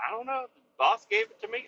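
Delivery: very high mocking voice of her; imitating coworker. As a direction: squeak her excuse. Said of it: "She's like".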